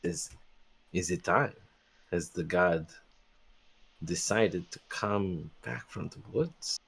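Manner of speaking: hushed, leaning in. Acted. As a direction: lean close and drop the voice. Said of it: "he leans in close to Flaskly".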